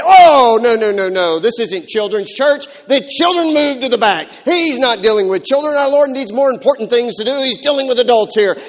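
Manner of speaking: loud, high, bossy shooing; officious ushers. Acted. Disciples turning children back from the front, loud and officious. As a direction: overrule them at full volume like a self-important usher.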